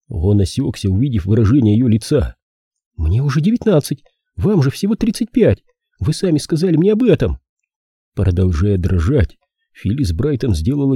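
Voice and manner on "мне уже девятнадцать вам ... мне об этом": put-on high-pitched protesting girl; young woman character. Girl rebuts the detective about her age, high protest. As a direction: argue back in a raised girlish voice.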